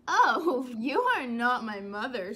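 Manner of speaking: loud startled oh, with a laugh; surprised baby bird. Acted. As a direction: realise your mistake with a jolt. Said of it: "said the baby bird".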